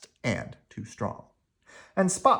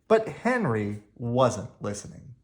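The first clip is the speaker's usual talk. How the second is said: ordinary delivery, nothing marked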